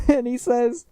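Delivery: normal talking voice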